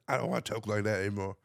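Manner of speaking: soft, quick grumble; hushed, hurried complaint; reluctant early American. Acted. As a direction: say it under your breath, quickly. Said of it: "then they're like".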